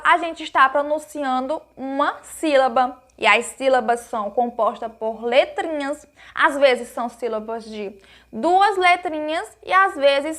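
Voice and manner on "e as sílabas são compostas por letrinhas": ordinary delivery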